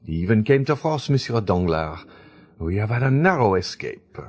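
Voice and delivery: a simple society mother, relieved; Baroness speaking. A narrator's reading. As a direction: Baroness to the ladies, lightly voiced and relieved. Baroness voiced in the story.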